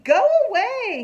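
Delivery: very high, loud brush-off; yelling. A narrator's reading. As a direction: snap her away. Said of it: "he yells at her".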